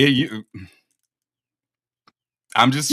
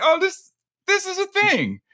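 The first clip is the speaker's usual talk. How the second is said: high, amused mock bewilderment; mock bewildered; 4 dB louder than usual. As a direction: play up your own old confusion, going up high. Recalls puzzling over the accent.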